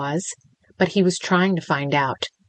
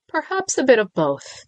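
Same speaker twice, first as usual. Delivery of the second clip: coy, high and playful; 7 semitones higher than usual. A narrator's reading. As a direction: tease her with it. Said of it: "came the coy response".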